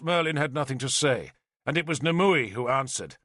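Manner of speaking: usual speaking voice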